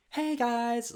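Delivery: high, chirpy mock greeting; campy greeting. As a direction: squeak out a bouncy hello. The guy's greeting, impersonated.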